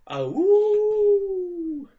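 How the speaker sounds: high howling cry; wolf howl. Pretend.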